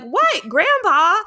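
high, slow drawn-out question; cousins asking. Retold or imitated. Cousins quizzing grandpa, high and slow. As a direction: stretch it out like a puzzled cousin. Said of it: "all my other cousins will be like".

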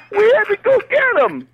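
high, loud strained comic voice; bombing comedian. Acted. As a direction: play a desperate comic onstage. Hammed-up comedian bit.

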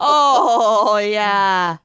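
laughing Minnesota "Oh, yeah"; hammed-up Upper Midwest drawl; Minnesotan accent. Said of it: "unleash their worst Minnesotan accents".

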